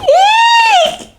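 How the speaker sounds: very high squeal; high eek of fright; mock scream.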